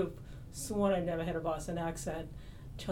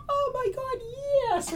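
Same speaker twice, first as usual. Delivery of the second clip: loud, very high-pitched exclamation; hammed-up high squeal of a reply; roommate's mocking echo; 6 dB louder than usual. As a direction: imitate an over-the-top roommate's reaction. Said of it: "And she was like".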